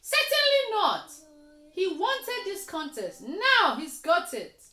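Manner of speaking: high, loud, huffy refusal; smug indignant outburst. Acted. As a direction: refuse flatly and scornfully. Frog refuses to help.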